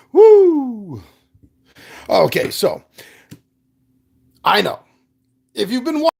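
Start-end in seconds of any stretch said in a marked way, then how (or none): 0.1-1.0 high, loud whoop; excited cheer. Real, not acted. Cheering himself on.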